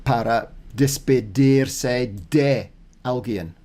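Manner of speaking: "Para despedirse de alguien" slowly, each word clear; slow deliberate reading; slow careful Spanish. Shown as a demonstration. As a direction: read it slowly as practice. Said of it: "to say goodbye to someone".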